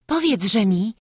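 usual speaking voice

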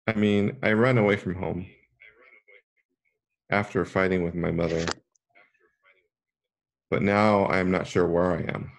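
put-on a hurt girl pouring out her grievance; upset forest girl.

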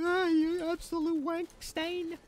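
hammed-up London bloke voice; mock British insults. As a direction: drag out the abuse like a cheeky Brit. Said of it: "Why do I sound like that?".

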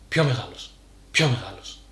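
nagging voice inside the head, each phrase falling away; little inner voice. Acted. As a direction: play the insistent little voice in his head. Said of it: "σωπαίνει για λίγο".

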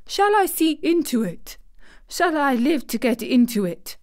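high-pitched, desperate questioning; half-sobbing cry. A narrator's reading. Weak boy asks whether he will live to see it, half-sobbing. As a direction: plead as if close to tears. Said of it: "cried out with a half sob".

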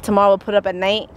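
usual speaking voice

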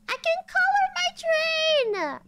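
high, slow, thrilled squeal; excited squeal. Real, not acted. Excited about painting train.

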